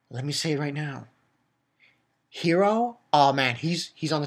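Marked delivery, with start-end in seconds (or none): 0.1-1.1 same words said again, softer; softer repeat. Shown as a demonstration. Recapping the episode, repeats it quietly. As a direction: drop the voice on the repeat.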